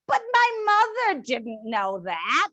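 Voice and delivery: ordinary delivery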